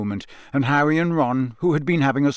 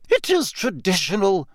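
higher, firm teacher; firmly; 6 semitones higher than usual. A narrator's reading. McGonagall overruling Harry, firm. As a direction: state it as final. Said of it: "said Professor McGonagall firmly".

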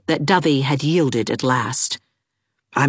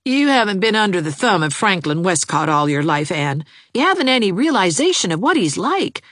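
lightly voiced eager young man; a hint of a lovesick young suitor, pleading; 6 semitones higher, faster than usual. A narrator's reading. Jarvis's voice in the story.

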